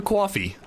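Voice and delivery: "coffee" as KWAW-fee; thick New York pronunciation. Pretend.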